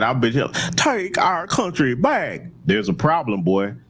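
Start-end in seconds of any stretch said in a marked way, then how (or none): 0.8-2.4 drawn-out parody of a politician's slogan; mock rehearsed slogan. Host mocks the candidate's practised line, drawn-out mimicry. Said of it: "you practice that all day and night".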